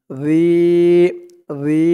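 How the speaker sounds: রি with a lengthened, held i; one-alif stretch. Shown as a demonstration. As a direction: draw out the vowel in রি. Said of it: "এক আলিফ টান". (রি এক আলিফ টেনে)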